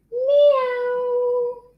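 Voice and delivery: high, long, drawn-out meow; high singsong kitty call; cat meow. Shown as a demonstration. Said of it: "And the cat".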